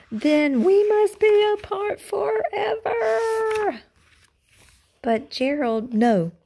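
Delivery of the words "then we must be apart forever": high slow wailing lament; melodramatic sad. Acted. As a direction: wail it dramatically. Gerald dramatic farewell.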